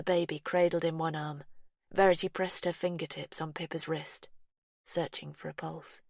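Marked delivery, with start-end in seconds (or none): none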